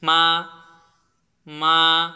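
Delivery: মা climbing from middle pitch; rising second tone. Shown as a demonstration. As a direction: raise মা steadily upward, loudly. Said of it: "middle level থেকে high লেভেলে উঠে গেলাম".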